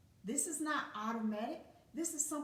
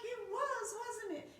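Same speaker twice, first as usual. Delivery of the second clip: coy mock modesty; 11 semitones higher than usual. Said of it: "And then I'll be like".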